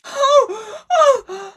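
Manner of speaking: high, slow sobbing disbelief; hysterical crying. Acted. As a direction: cry it out in shock. Acted Big Brother grief scene.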